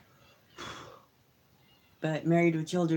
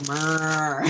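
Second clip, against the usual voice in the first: drawn-out Southern cow murr, louder; Southern cow moo; 6 dB louder than usual. Retold or imitated. Joking that Southern cows have accents, Southern moo. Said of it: "in the South, they go".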